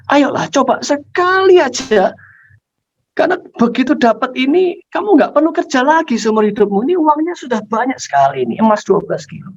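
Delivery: high, insistent pleading; coaxing father. Acted. Father urging his child to steal just once, coaxing. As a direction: talk them into it, voice pitched high.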